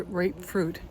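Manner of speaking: usual speaking voice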